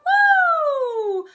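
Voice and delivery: high, loud, hooty "woo"; classical head-voice woo. Shown as a demonstration. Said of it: "this head voice feeling".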